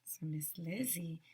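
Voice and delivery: unvoiced hushed whisper; secretive whisper to the class. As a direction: lean in and whisper the name. Whispered storytelling aside.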